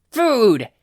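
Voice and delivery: high, loud greedy yell; hungry shout. Acted. Hungry coworker grabbing peppers, loud eager shout. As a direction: pounce on the food.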